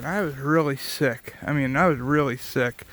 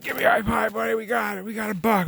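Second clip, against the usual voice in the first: higher, thrilled dad voice; dad celebrating the buck with his son, excited and higher; 6 semitones higher than usual. Retold or imitated. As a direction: imitate dad cheering over the buck. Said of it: "And he was all excited".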